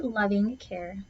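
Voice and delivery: plain reading voice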